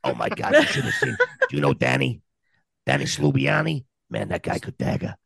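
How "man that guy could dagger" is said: gruff reminiscing. Pretend. Old New Yorker reminiscing.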